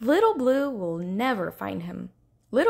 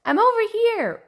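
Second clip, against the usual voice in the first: high, louder playful call; laughing shout; 12 semitones higher, 6 dB louder than usual. Acted. Redfin popping up behind Rainbow Fish, playful shout. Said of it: "shouted laughingly".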